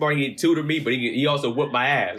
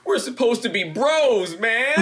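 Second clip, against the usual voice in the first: higher, slow tearful whine; crying plea; 9 semitones higher, slower than usual. Retold or imitated. To his brother after the injury, tearful high plea. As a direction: plead like a hurt kid. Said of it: "I was sitting there crying".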